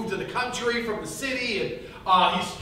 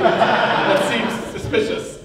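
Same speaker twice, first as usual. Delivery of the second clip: drawn-out suspicious; 6 dB louder, much slower than usual. Shown as a demonstration. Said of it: "That seems suspicious if that's the case".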